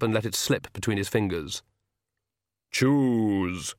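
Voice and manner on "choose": Death voice. Acted. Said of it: "he said".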